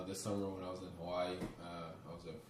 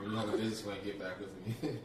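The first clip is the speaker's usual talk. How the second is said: laughing; 3 semitones higher, 9 dB louder than usual. Real, not acted. Mock reply to beggars.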